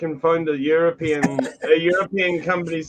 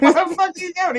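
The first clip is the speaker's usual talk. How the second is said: high mock outcry through laughter; incredulous, laughing; 4 dB louder than usual. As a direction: imitate yelling at a reckless friend while cracking up. Retells exclaiming at friend, laughing.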